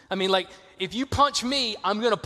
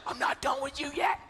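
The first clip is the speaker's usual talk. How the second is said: softer, pointed warning aside; mock menacing mom under her breath; covered-phone threat; 6 semitones higher, 4 dB quieter than usual. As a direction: mom hissing at her boys with the phone held away. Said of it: "she was like".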